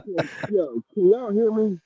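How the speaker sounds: muffled, far from the mic. Real, not acted. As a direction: voice coming through a wall. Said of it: "Nah, you're muffled".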